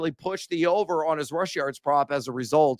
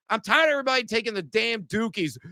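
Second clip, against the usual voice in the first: ordinary delivery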